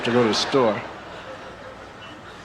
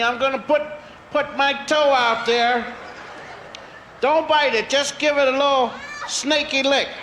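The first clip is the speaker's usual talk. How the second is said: calling from far away; 12 semitones higher than usual. Acted. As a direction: call out to the snakes, pleading. Child pleads with snakes.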